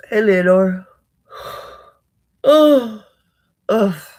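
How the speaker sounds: loud yawn breaking into a greeting; yawned words, rising high and loud. Real, not acted. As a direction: greet someone through a big, real yawn. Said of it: "I apologize in advance for yawning".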